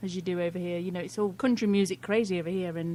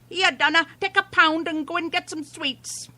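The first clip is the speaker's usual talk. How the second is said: light mock Irish grandma, a little higher; imitating Irish grandma; 7 semitones higher than usual. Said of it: "an Irish grandma".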